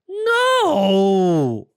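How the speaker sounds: loud sharp no; alarmed cry. Acted. Rabbit stopping the porcupine from flushing the truck, loud. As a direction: shout to stop him.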